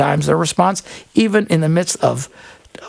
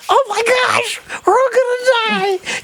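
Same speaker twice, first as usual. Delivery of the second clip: panicked cry; slower than usual. Retold or imitated. Tourist among elephants at night, screaming in mock terror. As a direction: shriek it like you're sure you're doomed.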